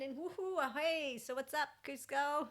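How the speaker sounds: high, loud cheerful hello; excited greeting. Real, not acted. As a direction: call out to the pet with delight.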